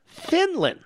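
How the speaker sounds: "Finland." jumped up high in mock wonder. Shown as a demonstration. Host mocking the caller's Finland example, sarcastic lift.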